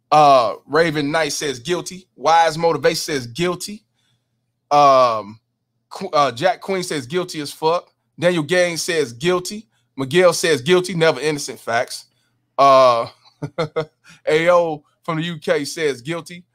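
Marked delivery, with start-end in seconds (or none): none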